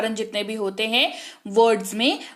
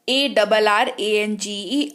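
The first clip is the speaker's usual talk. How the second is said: 1 slow spelling; 2 semitones lower, slower than usual. Shown as a demonstration. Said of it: "फिर है हमारे पास arrange".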